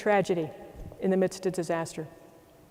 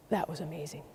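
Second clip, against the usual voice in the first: much softer, lower, almost to himself; awed hush; 3 semitones lower, 11 dB quieter than usual. Retold or imitated. Dave outside the building, quietly awed. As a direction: let the wonder hush the voice.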